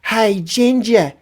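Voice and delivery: high, drawn-out mock UK lad voice; chavvy British heckler. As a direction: call out slowly from a car window, mocking. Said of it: "pokes his head out of the window and he goes".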